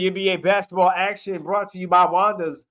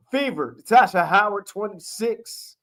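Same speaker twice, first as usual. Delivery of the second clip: normal talking voice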